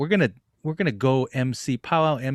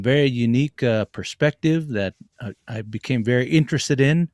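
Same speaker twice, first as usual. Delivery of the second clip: ordinary talk, nothing marked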